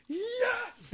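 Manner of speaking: high, hushed mock cheer; excited fan. Retelling a moviegoer's reaction to Adam Warlock, excited. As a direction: squeal it small, imitating a thrilled fan. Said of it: "he was so excited".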